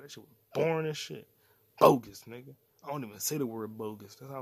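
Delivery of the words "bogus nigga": loud scoff. Real, not acted. Repeating his verdict on the bad season, loud scoff. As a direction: spit it out, disgusted and loud.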